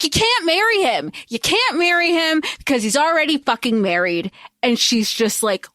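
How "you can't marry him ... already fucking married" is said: high-pitched exasperated telling-off; heated retold reprimand, pitched up; mock scolding yell. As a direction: imitate an adviser at the end of his patience. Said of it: "Cecil yells at her".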